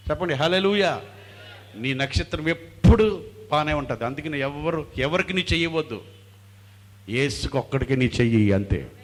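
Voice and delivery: loud, emphatic sermon delivery; rally the congregation with full voice; rousing preaching. Real, not acted. Preacher exhorts at full force.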